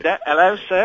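light mock-British politeness; a touch of posh English butler; British accent. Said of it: "Did you say it with a British accent?".

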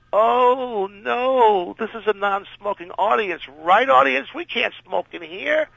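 high, loud, teasing appeal to the audience; playful high crowd-rousing call; showman rousing the crowd. Retold or imitated. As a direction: egg the crowd on against the star. Playing to the studio audience.

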